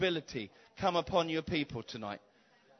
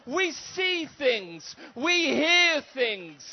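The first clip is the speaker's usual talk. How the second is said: slow, loud, high cry breaking through; slow declaration; 7 semitones higher, 6 dB louder, much slower than usual. Acted. Preacher proclaiming the church's gifts, slow crying shout. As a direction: drag each word out in a crying shout.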